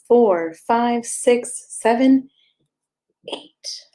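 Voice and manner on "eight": ordinary delivery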